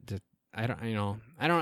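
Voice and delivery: ordinary talk, nothing marked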